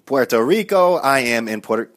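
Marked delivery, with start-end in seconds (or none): 0.1-0.9 loud, booming ring announcer in Spanish; boxing ring announcer. Acted. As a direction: call it out like introducing a fighter. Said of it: "I sound like a good boxing announcer".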